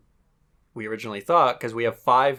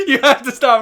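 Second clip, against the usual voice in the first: loud; 13 semitones higher, 10 dB louder than usual. Real, not acted. Laughing protest at impression.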